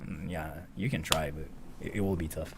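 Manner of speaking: low, soft and unconvinced; hushed, skeptical murmur; doubtful quiet reply. Retold or imitated. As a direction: let her down gently under your breath. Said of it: "And I was like".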